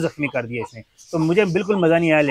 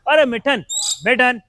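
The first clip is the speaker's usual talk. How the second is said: calling out; 8 semitones higher, slower than usual. Real, not acted. Calling the cat to stop it. (ऊँची, तेज़ आवाज़ में पुकारना)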